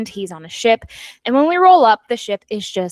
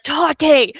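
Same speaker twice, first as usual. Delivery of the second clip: higher mock yell of a name; furious call, retold; 5 semitones higher, 4 dB louder than usual. Villain charging up the stairs at Tati, retold furious call. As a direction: imitate an angry shout, only half full force. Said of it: "he's, like, furious".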